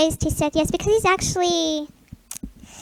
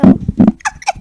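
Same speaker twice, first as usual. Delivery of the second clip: squeaky laugh; 11 dB louder than usual. Real, not acted.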